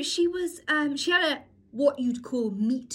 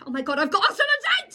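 high, loud, rushed squeal; excited announcement; 9 semitones higher, 6 dB louder, faster than usual. Retold or imitated. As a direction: blurt out the big news. Mum bursting with news, retold.

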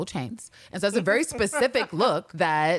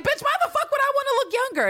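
high, fast mock-indignant comeback; imitating an outraged retort, pitched way up; 3 dB louder, faster than usual. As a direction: fire it back like an affronted diva. Acts out Dasha's indignant retort.

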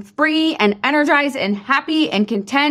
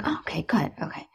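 soft unvoiced whisper of a little girl; whispered child reply; 5 semitones lower, 11 dB quieter, faster than usual. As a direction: whisper it like an accepting kid. Daughter accepts correction.

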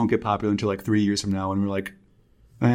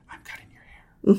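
ASMR whisper. Shown as a demonstration. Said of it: "a whisper. A S M R".

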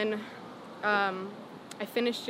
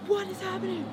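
stunned disbelief; 10 semitones higher than usual. Retold or imitated. Retold shock at dream band reply.

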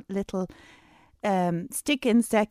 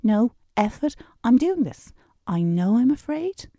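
higher, louder determined declaration; firm, raised inner voice of resolve; emphatic decision; 5 semitones higher, 4 dB louder than usual. Retold or imitated. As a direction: announce the decision like nothing can stop you. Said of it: "a real emphatic effort decision".

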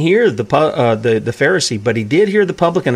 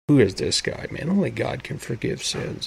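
muttering under breath; 2 semitones lower, 9 dB quieter than usual. Retold or imitated. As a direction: mutter it under your breath like a suspicious Pharisee. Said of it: "whispering under their breath".

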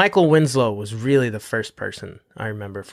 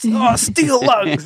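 higher, slow, drawn-out comic strongman cry; mock heroic; 9 semitones higher, 4 dB louder, much slower than usual. Joking about the image of steel lungs, mock heroic. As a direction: stretch it out as a hammed-up boast. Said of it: "the image is really".

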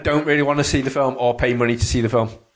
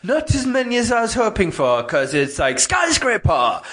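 weird high voice; 9 semitones higher than usual. Retold or imitated. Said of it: "I don't know what that voice was".

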